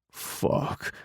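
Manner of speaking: soft breathy curse under the breath; muttered curse. Acted. Biker seeing the fatal wound, muttering under his breath. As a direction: swear to yourself, barely audible. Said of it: "Rainbow mutters when he sees the injury".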